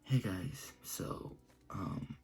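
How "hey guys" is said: ordinary talk, nothing marked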